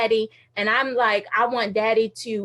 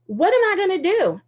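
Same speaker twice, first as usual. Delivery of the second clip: stressed out; 8 semitones higher, 3 dB louder than usual. Retold or imitated. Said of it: "And so I was stressed out".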